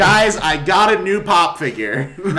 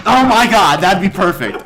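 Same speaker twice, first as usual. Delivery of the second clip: loud, raised-pitch exclamation; excited; 4 semitones higher, 9 dB louder than usual. Real, not acted. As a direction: burst out at a great idea. Excited by a sudden idea.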